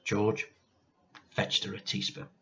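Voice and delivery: plain reading voice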